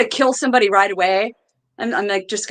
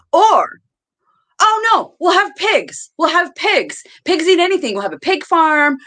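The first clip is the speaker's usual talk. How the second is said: excited; 8 semitones higher, 3 dB louder than usual. Real, not acted. Excited new idea.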